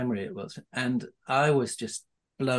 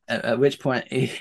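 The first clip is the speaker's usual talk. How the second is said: ordinary talk, nothing marked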